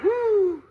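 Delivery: loud, high, held "whoo"; high whoop. Retold or imitated. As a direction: let out a sustained high whoop. Acts out shifting lanes in a hurry.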